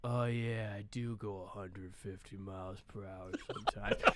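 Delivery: low, flat monotone tough guy; a deep flat monotone movie tough guy; Vin Diesel impression. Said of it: "Vin Diesel impression here".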